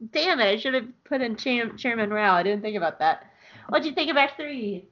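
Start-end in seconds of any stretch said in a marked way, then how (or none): none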